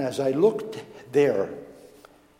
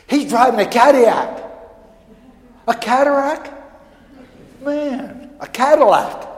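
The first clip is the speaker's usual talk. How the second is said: higher and louder, amazed over the Cadillac; excited disbelief; 9 semitones higher, 11 dB louder than usual. Retold or imitated. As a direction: marvel at the car like a kid. Father and son marvel at car.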